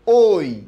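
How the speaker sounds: ওই said loud and clear as one gliding vowel; demo vowel oi. Shown as a demonstration. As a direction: belt out ওই so the class hears the glide. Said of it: "এইটা আমি কি লিখছি?".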